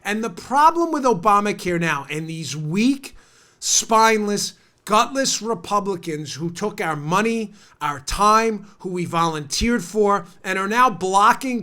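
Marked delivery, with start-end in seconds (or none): none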